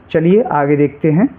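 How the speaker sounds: ordinary talk, nothing marked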